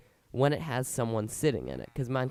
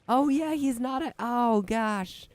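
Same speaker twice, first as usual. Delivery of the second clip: surprised; 12 semitones higher, 5 dB louder than usual. Real, not acted. Realizes villager can't trade.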